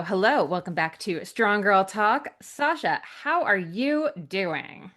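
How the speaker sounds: normal talking voice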